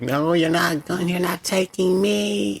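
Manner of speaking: high, slow, desperate whine; whiny pleading voice. Retold or imitated. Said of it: "He's like".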